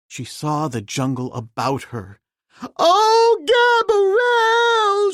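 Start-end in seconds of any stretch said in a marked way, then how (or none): 2.8-5.1 shrill terrified scream. A narrator's reading. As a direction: scream it high, then faint. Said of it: "she screamed and fainted".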